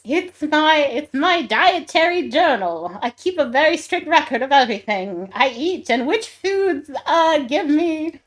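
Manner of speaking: high, loud nervous excuse; flustered villain. Acted. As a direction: cover up like a caught villain.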